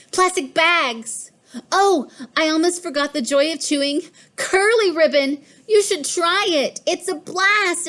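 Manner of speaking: high, excited gushing; bubbling cat delight; gleeful. A narrator's reading. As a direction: gush about the joy of it. Cat gushing about chewing ribbon.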